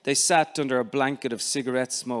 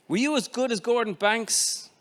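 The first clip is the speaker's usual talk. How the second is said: put-on eager child; 7 semitones higher than usual. Eager child asking father.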